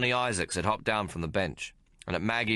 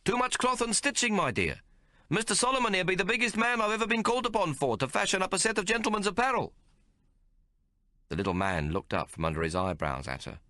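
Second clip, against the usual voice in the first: high, quick wheedling pitch; haggling tailor; 14 semitones higher than usual. A narrator's reading. Tailor telling Maggie the giant costs more, wheedling. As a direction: talk the price up fast.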